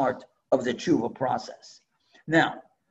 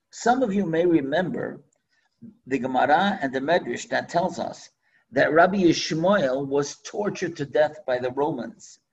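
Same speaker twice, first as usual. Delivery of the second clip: normal talking voice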